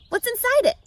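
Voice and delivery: high, loud eager question; Wilbur the pig. A narrator's reading. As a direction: pitch up, curious piglet.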